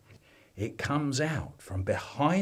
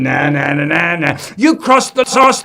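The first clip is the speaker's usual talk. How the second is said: loud singsong taunt; taunting sibling; 12 dB louder than usual. Retold or imitated. Brother taunting narrator in the car, singsong jeer. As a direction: jeer like a bratty kid.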